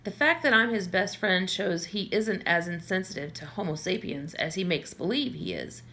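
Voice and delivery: usual speaking voice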